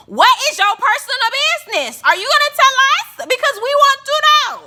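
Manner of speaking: high, loud insistent questioning; playful loud demand at Kiki; mock demand.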